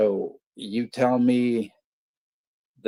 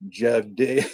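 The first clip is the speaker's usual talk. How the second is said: normal talking voice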